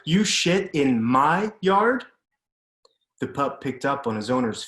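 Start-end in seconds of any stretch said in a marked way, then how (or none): 0.0-2.1 loud, higher and slow, each word hammered; slow furious shout. Retold or imitated. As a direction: drag it out, menacing, right in his face. Dad repeats, raging.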